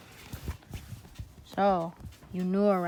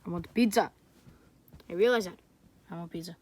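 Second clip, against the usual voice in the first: ordinary delivery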